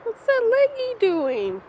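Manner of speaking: high, slow coo at the cat; slow, high-pitched pet talk. Real, not acted. Talking to cat in baby voice.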